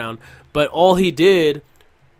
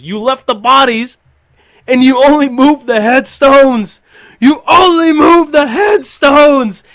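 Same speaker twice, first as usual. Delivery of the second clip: higher, louder, slow angry shouting; reenacted furious yell; 10 semitones higher, 9 dB louder, slower than usual. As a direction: bellow it at him, outraged. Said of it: "And then he says".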